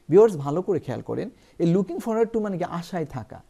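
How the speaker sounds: ordinary talk, nothing marked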